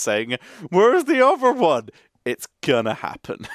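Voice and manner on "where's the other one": high, loud mock demand; loud, high-pitched mock complaint; whiny commenters. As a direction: imitate pestering commenters, pitched way up. Acting out commenters' demanding question.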